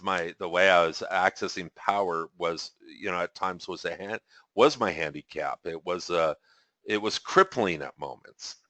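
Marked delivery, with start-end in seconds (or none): none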